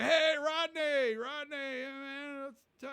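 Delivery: high, slow call of Rodney's name; excited greeting. Retold or imitated. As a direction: mimic Attell calling out across the bar. Scene: Attell calling out at bar.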